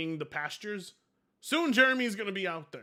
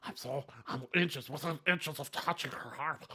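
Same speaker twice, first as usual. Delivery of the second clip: mocking voice; 6 semitones lower, 6 dB quieter than usual. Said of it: "he's like".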